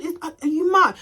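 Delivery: retold angry disbelief; pissed off. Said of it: "I'm pissed off and I'm cussing her out".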